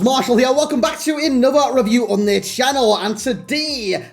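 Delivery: high-pitched attempt at a Scouse accent; bad Scouse accent. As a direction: put on a squeaky, hammed-up Scouse. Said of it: "I do apologise for my Scouse accent".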